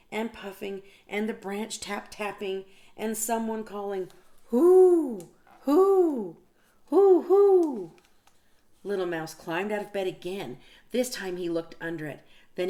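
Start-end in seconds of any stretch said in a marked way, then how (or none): 4.5-8.0 loud, high hooting owl. Acted. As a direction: hoot it out like an owl in the night. Narrator voices the owl.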